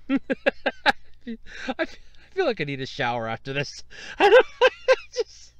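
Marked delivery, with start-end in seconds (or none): 4.2-5.5 breaking up with laughter; laughing. Real, not acted. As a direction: talk through uncontrollable laughter. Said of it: "it's just making me laugh so bad".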